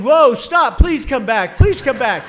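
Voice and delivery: frantic loud plea; yelling. Retold or imitated. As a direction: beg her loudly to come back. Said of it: "I'm yelling".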